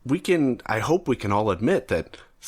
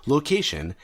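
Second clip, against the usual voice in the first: usual speaking voice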